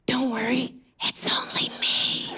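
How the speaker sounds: slow, hushed whisper. Retold or imitated. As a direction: lean in and whisper it slowly. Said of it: "he just leaned forward and whispered".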